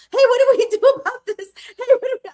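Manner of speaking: high, loud, rushed plea laughing through it; mock frantic call. Coworker frantically calling her about a problem, high and loud. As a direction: play the panicked coworker calling for help.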